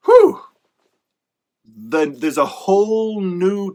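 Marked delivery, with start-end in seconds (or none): 0.1-0.4 high, loud whew of mock relief. Author voiced finishing the book, loud whew. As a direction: blow out a big relieved whew.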